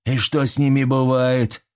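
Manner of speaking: usual speaking voice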